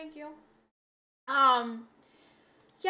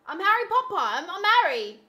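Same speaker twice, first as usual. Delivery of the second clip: higher, louder mock announcement; hammed-up Harry Potter intro; 6 semitones higher, 8 dB louder than usual. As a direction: proclaim it like a goofy reveal. Repeats cosplay joke bigger.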